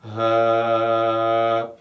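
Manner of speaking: long, level schwa held on one note; drawn-out forward neutral vowel; sustained "huh". Shown as a demonstration. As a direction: hold "huh" out steadily, feeling it vibrate. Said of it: "as you sustain that voice".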